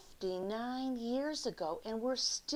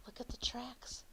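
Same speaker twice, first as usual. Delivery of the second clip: whisper; 3 semitones higher, 8 dB quieter than usual. Real, not acted. Pointing out picture to kids.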